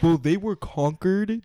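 put-on pompous commenter; mock online commenter. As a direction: draw it out as a mocking correction. Continuing the mocked commenter's argument.

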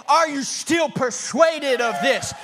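high, loud, slow pleading question; preaching question. Acted. Preacher challenging the congregation, slow loud call. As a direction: drag it out to the crowd. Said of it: "I'm here to ask you today".

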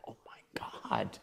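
slow, stunned whisper; awestruck whisper. Retold or imitated. Swimmer hearing the Olympic director on the phone, awestruck whisper. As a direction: whisper it slowly, as if floored.

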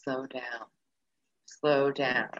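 soft, low inner voice; a hushed reminder to slow down. Retold or imitated. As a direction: say it softly to yourself. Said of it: "all I'm thinking is".

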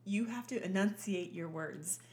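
carefully enunciated, slowing right down; measured, very precise lecture voice; proper mother. Retold or imitated. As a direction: imitate a strict mom demanding clear diction. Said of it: "one of the most proper Southern women".

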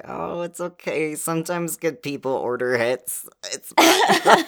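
high, hammed-up airy mock quote. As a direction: mimic her brushing it off, sing-song. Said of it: "she's like".

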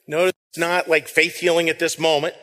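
ordinary delivery

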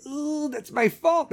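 a caught parent owning up, drawn out; sheepish parent. Retold or imitated. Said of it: "you're just gonna be like".

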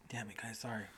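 whispered, breathless after coughing; whispered apology. Real, not acted. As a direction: whisper it, still recovering.

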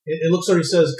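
normal talking voice

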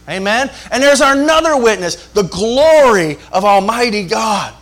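usual speaking voice

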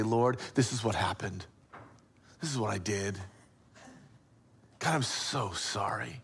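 soft, low, halting confession; remorseful confession. Acted. Cain honestly confessing to God, softly remorseful. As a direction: confess quietly with long pauses.

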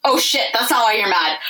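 ordinary talk, nothing marked

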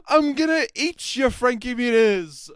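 squeaky loud, slow monster threat; hammed-up goblin, very high; cartoon monster announcing dinner. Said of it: "and it goes".

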